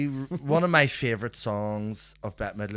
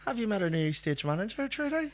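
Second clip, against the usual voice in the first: high, light mimicking voice; imitated colleague; 10 semitones higher than usual. Colleague introducing the stage manager, mimicked high voice.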